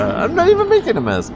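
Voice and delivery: high, loud protest through real laughter; laughing protest. Real, not acted. Host answers co-host's tease about mess, laughing protest. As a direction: laugh while loudly denying it.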